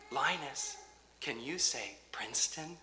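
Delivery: put-on soft sing-song talk to a toddler; baby voice. Shown as a demonstration. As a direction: coo it like to a baby. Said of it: "doing my best baby voice".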